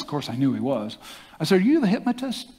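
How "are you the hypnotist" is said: nervous question. Retold or imitated.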